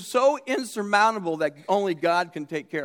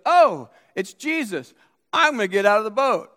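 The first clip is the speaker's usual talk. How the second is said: acted eager excitement; 3 semitones higher, 5 dB louder than usual. Preacher as Peter spotting Jesus from the boat, acted excitement. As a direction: blurt it out as impetuous Peter.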